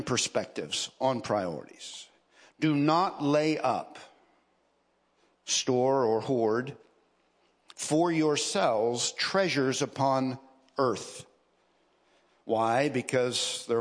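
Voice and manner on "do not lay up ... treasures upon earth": slow, deliberate Bible reading; scripture reading. Acted. Preacher reading Jesus' words on treasure to the congregation, slow scripture reading. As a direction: read the verse out slowly, weighing each phrase.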